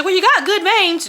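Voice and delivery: high, sing-song mock nurse voice. Patient mocks the nurse who kept blowing veins, high and chirpy. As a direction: parody the nurse's cheery excuse. Nurse quoted during failed IV.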